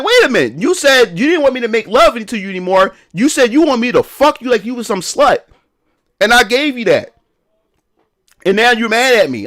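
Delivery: mock-indignant, wait a minute; indignant retort.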